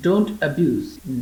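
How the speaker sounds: very slow, clearly spaced teacher read; slow model sentence. Shown as a demonstration. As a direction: spell it out slowly for learners. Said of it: "teacher models English sentence". (ધીમે ધીમે સ્પષ્ટ ઉચ્ચાર)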